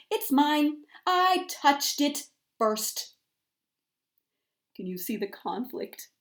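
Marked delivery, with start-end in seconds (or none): 0.1-3.1 higher, louder, slow insistence; insisting. A narrator's reading. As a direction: stake the claim, slowly. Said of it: "Goose quickly raised one web foot".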